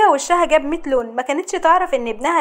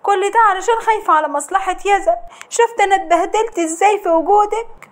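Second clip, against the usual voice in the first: higher, put-on weepy plea; whining to her mother-in-law with fake tears; fake tearful whine; 6 semitones higher than usual. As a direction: play the victim with crocodile tears. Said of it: "نزلت الدمعتين بتوعها".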